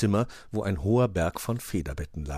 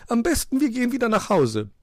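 high, loud lady magpie; prim magpie objecting; 6 dB louder than usual. A narrator's reading. Magpie warns against entering strange houses, objecting.

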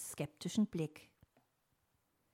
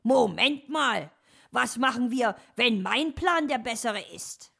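loud, raised objection; protesting; 6 semitones higher, 9 dB louder than usual. Acted. Fox interrupts the wedding deal, loud protest. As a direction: butt in to halt the agreement.

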